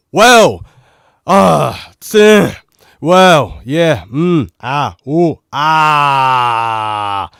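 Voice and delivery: loud high yelled fillers; testing the mic with shouted noises; shouting. Shown as a demonstration. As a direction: belt out short shouts to test distortion. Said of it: "the next part is gonna be me shouting".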